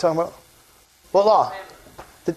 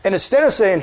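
ordinary talk, nothing marked

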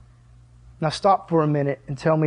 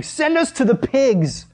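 higher, slow pleading demon voice; begging demons; 6 semitones higher than usual. Acted. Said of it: "begged him, saying".

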